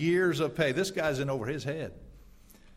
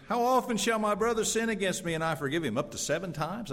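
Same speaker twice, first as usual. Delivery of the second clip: Peter asking; 7 semitones higher than usual. Acted. Peter voiced asking Jesus.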